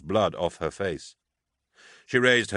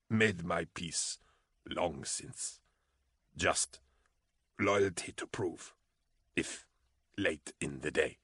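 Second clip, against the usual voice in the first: slow faint gurgling voice; fading, blood in the throat; death rattle; 2 semitones lower, 10 dB quieter, slower than usual. A narrator's reading. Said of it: "Van Voigt gurgled".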